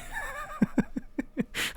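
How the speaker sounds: soft, breathy giggling; amused laugh. Real, not acted. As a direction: laugh quietly at a wild idea. Amused by imagined huge price rise.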